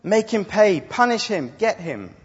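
high loud demand for punishment; shouting for vengeance. Acted. Abel's blood shouting to God, loud and high. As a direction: cry out for him to pay. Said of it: "Abel's blood is shouting".

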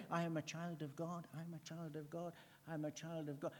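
mock rote recital. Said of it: "you've got this idea".

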